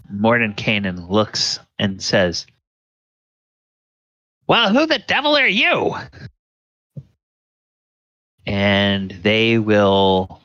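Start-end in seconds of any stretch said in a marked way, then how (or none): 4.5-6.0 high-pitched, indignant demand; haughty high-voiced challenge; indignant wizard. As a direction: pitch it way up, affronted by strangers. Game master voices Mordenkainen's startled question.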